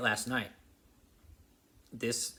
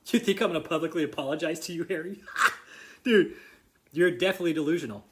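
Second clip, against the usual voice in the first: mocking laugh; 3 semitones higher, 5 dB louder than usual. Real, not acted. Mocking Harry's demand for apology.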